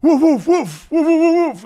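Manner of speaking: loud, high volley of imitated barks; dog barking. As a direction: bark like a dog running closer. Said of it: "Then we hear".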